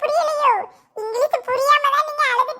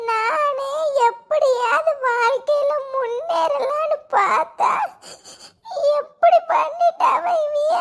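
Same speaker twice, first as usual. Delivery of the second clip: slow, drawn-out crying complaint; tearful wailing; slower than usual. Acted. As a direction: wail each phrase out through sobs. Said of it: "எதுக்குல உங்க அம்மா அழுகாத".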